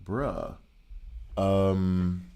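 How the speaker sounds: ordinary delivery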